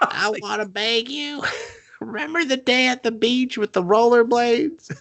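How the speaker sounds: high, whiny begging voice; mock pleading. Creep begging his old flame, high lovesick whine. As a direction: beg in a squeaky lovesick whine. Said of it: "And he's still just like".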